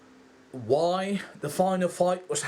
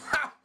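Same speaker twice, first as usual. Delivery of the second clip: short laugh; 13 semitones higher than usual. Real, not acted. Laughs at his own swearing.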